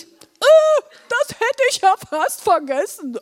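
very high, squeaky dopey voice; Blodi calling goodbye. Acted. Blodi remembers autograph when leaving, calling out. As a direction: blurt out a last request in a high character voice. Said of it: "Blodi rief zum Abschied".

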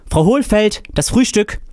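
high, carrying call for the landlady; calling down the hall. A narrator's reading. Fabian calls to his landlady down the corridor, high and carrying. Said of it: "und rief im Korridor".

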